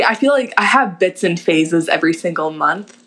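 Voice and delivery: ordinary delivery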